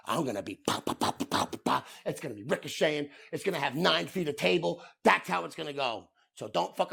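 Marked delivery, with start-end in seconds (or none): 0.1-1.9 puck popping sounds. Real, not acted. Imitating puck ricochet.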